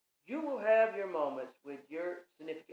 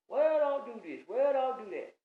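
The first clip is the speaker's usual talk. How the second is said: loud, higher mock nagging; nagging scold; 7 semitones higher, 8 dB louder than usual. Partner laying down the law in a loud nagging scold. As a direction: snap out the rules like a bossy partner. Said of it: "Just up like that".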